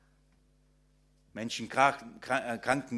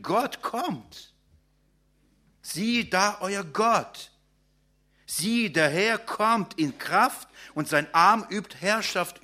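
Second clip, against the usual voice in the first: raised, ringing sermon voice, proclaiming each line; preaching; 5 semitones higher, slower than usual. Acted. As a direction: proclaim it to the congregation with rising fervour. Proclaims God's coming.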